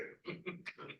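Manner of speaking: quiet little chuckle to oneself; soft chuckle. Real, not acted. Storyteller amused at the bride leaving town, soft chuckle. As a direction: chuckle softly under the breath. Laughs at his own story.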